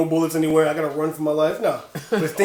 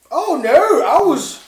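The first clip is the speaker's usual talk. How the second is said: mock fright; 9 semitones higher, 7 dB louder, much slower than usual. Recalling Resident Evil fright.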